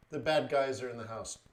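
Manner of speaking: normal talking voice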